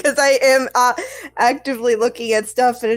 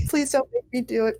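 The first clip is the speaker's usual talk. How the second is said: normal talking voice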